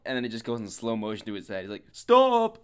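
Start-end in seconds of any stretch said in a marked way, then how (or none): 1.9-2.6 very loud, high drawn-out yell; hammed-up imitation of a desperate scream; one long comic wail; mock screaming. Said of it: "I don't think he sounded like that".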